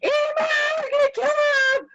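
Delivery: full-voiced household yell, acted out. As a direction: shriek it across the house. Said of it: "I need to tell my boys this".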